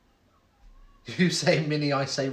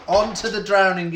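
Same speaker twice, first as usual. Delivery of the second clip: louder repeated announcement; announcing loudly; 3 semitones higher, 7 dB louder than usual. Acted. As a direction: call it out again, even louder. Quiz host repeats the announcement.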